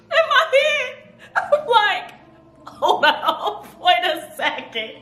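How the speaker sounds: high, squeaky voice breaking into laughter; laughing while talking. Real, not acted. Laughing while retelling her shock.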